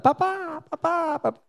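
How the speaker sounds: put-on a small girl calling for her father; child calling papa. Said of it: "comienza a gritar".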